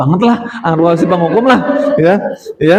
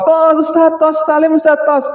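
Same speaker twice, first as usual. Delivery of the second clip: put-on a higher, chirpy kid calling for a high five; lightly voiced cheerful student; 7 semitones higher than usual. Pupil after sports asking the teacher for a high five, bright and eager. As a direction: play the eager pupil slapping hands with the teacher.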